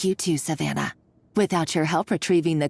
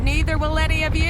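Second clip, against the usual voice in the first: fast, higher threat into a breathy cackle; maniacal laugh; 6 semitones higher, 4 dB louder, faster than usual. Acted. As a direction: cackle at dragging them all down.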